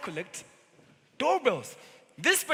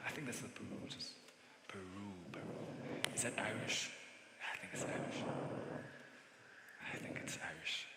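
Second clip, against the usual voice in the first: whispered, much softer, thinking aloud; muttered to himself; 14 semitones lower, 20 dB quieter than usual. Acted. Salesman muttering to himself over the name, whispering. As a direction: mumble it under your breath as you puzzle.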